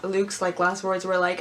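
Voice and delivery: usual speaking voice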